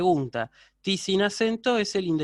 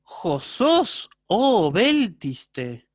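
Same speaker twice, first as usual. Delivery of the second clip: lectura dramática; 3 semitones higher, 6 dB louder, much slower than usual. Acted. Profesor lee a Sócrates.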